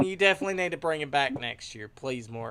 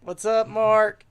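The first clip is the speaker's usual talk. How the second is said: excited greeting; 6 semitones higher, 6 dB louder, slower than usual. Real, not acted. Greeting Mark as he joins.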